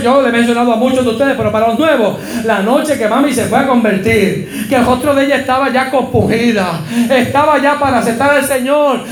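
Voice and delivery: ordinary delivery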